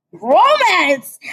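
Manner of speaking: high, loud teasing cry; mocking exclamation. Real, not acted. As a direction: shout it with a sneer.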